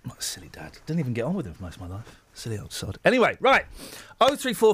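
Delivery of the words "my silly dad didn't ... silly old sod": much softer, lower, barely holding it together; fond grief under the breath; choked up. Real, not acted. Host talking to himself about his late father, choked and soft. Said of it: "I'm tearing up".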